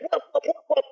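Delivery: high, repeated rotor whoops; imitated helicopter whooping. Describing a helicopter stuck on the roof, high imitated whooping. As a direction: imitate a stuck toy helicopter spinning noisily. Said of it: "going like".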